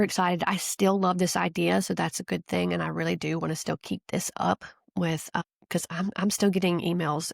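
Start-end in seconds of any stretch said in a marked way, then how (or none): none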